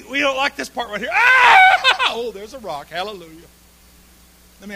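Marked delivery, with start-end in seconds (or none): 0.0-1.1 emphatic shout. Real, not acted. Preacher calling out emphatically. 1.1-2.0 high, loud yelp breaking into a laugh; mock panicked yelp. Believer stepping onto nothing, mock panicked yelp into laugh.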